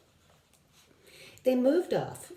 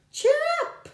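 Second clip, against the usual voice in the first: very high, louder bird squeak; 6 dB louder than usual. Acted. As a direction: chirp it like a small bird. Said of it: "she squeaked".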